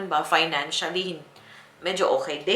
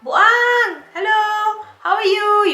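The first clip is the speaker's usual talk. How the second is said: much higher and louder, cheery sing-song; happy greeting; 10 dB louder, much slower than usual. Retold or imitated. Husband arriving home, greeting her cheerfully and loudly. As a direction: greet like you're thrilled to see them. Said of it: "Yung happy siya ba?".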